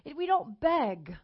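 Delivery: ordinary talk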